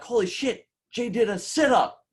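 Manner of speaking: amazed disbelief. Retold or imitated. Trainee stunned as his partner does a sit-up, squeaky disbelief. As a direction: gasp it out in disbelief.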